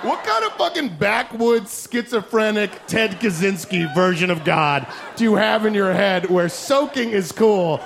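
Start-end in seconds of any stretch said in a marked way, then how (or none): none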